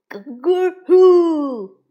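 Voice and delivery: long, slow, loud chicken crow; shouted cluck. A narrator's reading. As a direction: yell it out like a mocking rooster. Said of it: "他们大喊". (拖长声音大喊咯咯呼)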